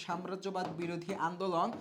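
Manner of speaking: normal talking voice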